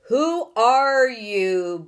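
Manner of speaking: loud, slow booming question; booming demand. Acted. Houston demands the strangers' names, slow booming voice. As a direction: boom it slowly from far away. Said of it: "boomed the voice from Houston".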